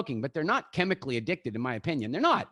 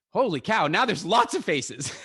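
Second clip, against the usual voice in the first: surprised; 5 dB louder than usual. Real, not acted. Seeing many faces on camera.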